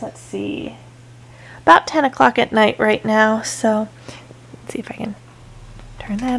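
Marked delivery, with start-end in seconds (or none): 0.0-0.8 breathy whisper, no voice. Real, not acted. Baby sleeping nearby. 4.5-6.4 breathy whisper, no voice. Real, not acted. Baby sleeping nearby.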